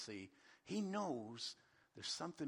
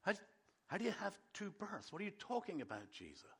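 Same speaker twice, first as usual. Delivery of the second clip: mock bewilderment, higher at first; confused; 4 semitones higher, 3 dB quieter than usual. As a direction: puzzle it out haltingly, a little softer. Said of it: "that confuses Nicodemus".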